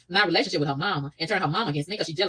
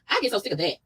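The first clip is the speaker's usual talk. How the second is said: ordinary delivery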